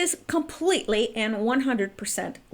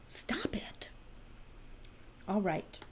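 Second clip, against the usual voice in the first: whispering; 3 semitones lower, 15 dB quieter, slower than usual. Pretend. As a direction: hiss it playfully. Whispered playful scolding.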